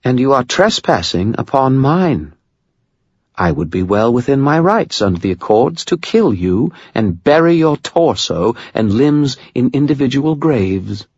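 high, formal British threat; precise British accent. A narrator's reading. Vampire baroness threatens trespassing wizard, precise British accent. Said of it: "in a precise British accent".

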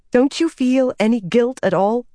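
demanding. Acted. Nynaeve to Rand, demanding he feel guilt. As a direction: demand an answer from him. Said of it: "she demanded".

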